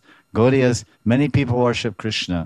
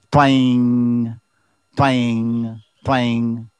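twanging bowstring; 2 semitones higher, 4 dB louder, much slower than usual. Shown as a demonstration. Teacher imitating a plucked bowstring for listeners, a slow twang. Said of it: "you pull the string, and it goes twang".

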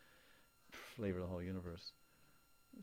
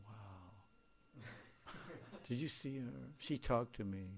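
infatuated youngster; 4 semitones higher, slower than usual. Retold or imitated. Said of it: "first is infatuation".

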